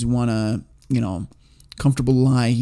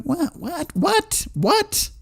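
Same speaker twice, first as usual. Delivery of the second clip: squeaky, much higher repeated what; rising higher each time in shock; disbelief; 5 dB quieter, much slower than usual. Real, not acted. Streamer reacting to a viewer hating Karate Kid, shocked.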